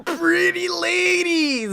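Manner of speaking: high, slow, put-on shout; goofy catchphrase shout. Cohost echoing the catchphrase in a high, slow put-on voice. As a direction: ham up the catchphrase.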